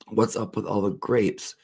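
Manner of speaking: ordinary talk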